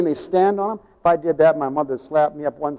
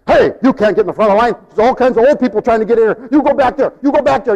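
loud, fast telling-off; retold helper yelling at a line-jumper; scolding yell; 5 semitones higher, 6 dB louder, faster than usual. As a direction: bark at a line-cutter. Bread-line man scolding line-cutter.

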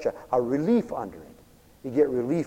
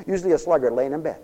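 usual speaking voice

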